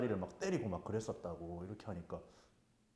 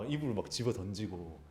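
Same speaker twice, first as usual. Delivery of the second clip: ordinary delivery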